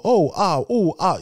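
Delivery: high little mock oohs and aahs; mimicked exclamations. Coach shows which exclamations to stop, mimicking them high. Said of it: "les petites exclamations liées à sa voix".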